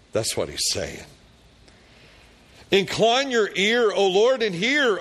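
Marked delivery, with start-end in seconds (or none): 2.7-5.0 much higher, louder pleading prayer; desperate plea lifted high and loud; fervent cry. Acted. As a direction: cry out to God as the king in distress. Prayer repeated with fervour.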